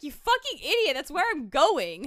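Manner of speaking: high insult, slowing into emphasis; acted scorn, stretching out the last words; exasperated retort. Woman snapping back at the detective, exasperated mock anger.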